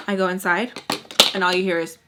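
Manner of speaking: normal talking voice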